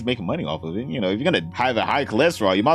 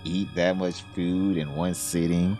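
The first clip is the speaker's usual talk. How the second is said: mocking coy voice; 5 semitones lower, 4 dB quieter than usual.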